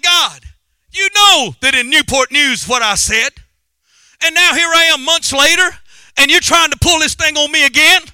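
loud, high protest to God; exasperated shout, retold from the pulpit; retold outburst to God. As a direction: cry out a complaint to heaven.

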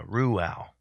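Ruel said as "ROO-al"; corrected pronunciation. Shown as a demonstration. Host correcting himself after hearing the app, Ruel pronounced "ROO-al". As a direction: say Ruel with a "roo" start, then a short "al". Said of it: "It's Ruel".

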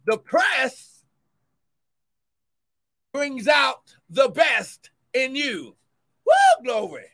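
loud, rising sermon cadence; fervent preacher building; preaching at full force. Acted. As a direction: drive the point home to the church. Preaching climax.

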